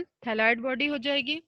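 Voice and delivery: ordinary talk, nothing marked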